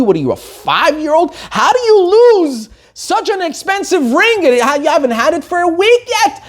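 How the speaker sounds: high, furious telling-off; angry berating. Retold or imitated. Aunt scolding the bride in public, shrill fury. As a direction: scold her in front of everyone. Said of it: "becomes very angry with the Kala".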